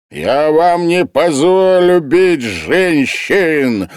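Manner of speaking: громко, высоко, медленно, с напором на «позволю»; кричать сверху, срываясь; пронзительный крик. A narrator's reading. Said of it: "пронзительный голос художника". (художник пронзительно кричит сверху)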